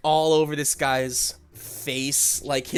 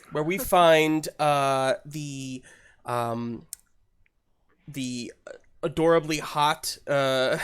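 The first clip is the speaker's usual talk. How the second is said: ordinary delivery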